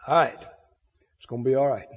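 low, soft, settled reassurance; relieved. Acted. Believer letting go of a worry, low soft relief. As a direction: exhale the words, quietly reassured. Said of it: "a big sigh of relief and go".

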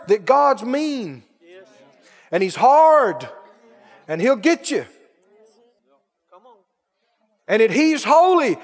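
loud, rising sermon cadence; fiery preaching. Acted. Preacher stirring up his church, full-force preaching. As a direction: preach it to the congregation.

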